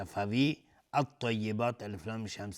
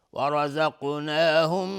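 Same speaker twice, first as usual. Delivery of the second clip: ورزقناهم chanted up high and louder with a rise; recited higher and louder; 5 semitones higher, 8 dB louder, much slower than usual. Shown as a demonstration. Teacher repeating the word in chanted recitation, louder.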